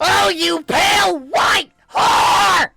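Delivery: very high, very loud slow scream; screaming insult. Acted. Mari raging at the marshmallow, furious scream. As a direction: scream it with fury at the game. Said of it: "Mari screamed".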